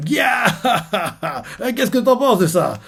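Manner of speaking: high, laughing pirate cheer; Blackbeard gloating. Retold or imitated. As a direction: crow with glee like Blackbeard. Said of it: "Barbe Noire". (Barbe Noire qui jubile en riant, voix haute)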